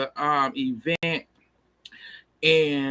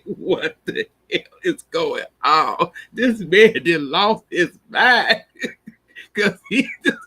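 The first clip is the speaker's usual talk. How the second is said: laughing; 5 dB louder than usual. Real, not acted. Cracking up at the scene.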